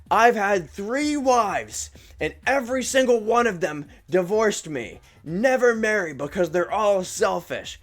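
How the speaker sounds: high, slow, drawn-out mock rant; imitated customer yelling. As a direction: mimic a drunk man's bitter tirade. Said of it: "this guy yelling from across the store".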